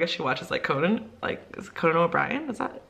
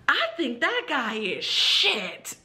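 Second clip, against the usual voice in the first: high, slow, deliberate pupil voice; imitated student; 8 semitones higher, much slower than usual. Student commenting on teacher's ex, slow and high-pitched. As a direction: mimic a child carefully delivering a sentence. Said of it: "she goes".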